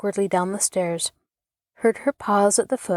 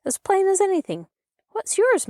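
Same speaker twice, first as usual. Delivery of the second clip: put-on fast, much higher, eager girl; excited declaring; 12 semitones higher than usual. A narrator's reading. Rosie showing off her paring, excited and fast. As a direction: rattle it off high and bright with glee. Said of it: "Rosie declared".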